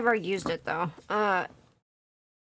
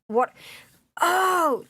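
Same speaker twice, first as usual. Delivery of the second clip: ordinary delivery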